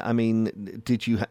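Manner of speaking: normal talking voice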